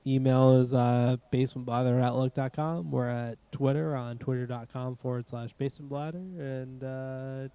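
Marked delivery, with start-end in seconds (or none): 5.6-6.2 Boston accent, bladder for blather; Massachusetts accent. Real, not acted. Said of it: "Massachusetts accent coming out".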